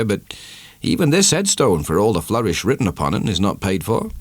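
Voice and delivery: a gossiping country sexton confiding; a hint of a chatty old villager; village sexton. A narrator's reading. Sexton voiced in story.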